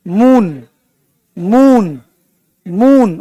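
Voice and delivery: मून with the long oo drawn out; stretched vowel. Shown as a demonstration. Teacher to class demonstrating the long sound, stretching the vowel. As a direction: stretch the oo in मून slowly, mooon. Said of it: "खींच रहा है".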